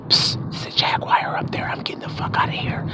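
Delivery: quick, hushed whisper; whispered warning. Acted. Hiker slipping away from a jaguar, whispered warning. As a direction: whisper it secretly and fast.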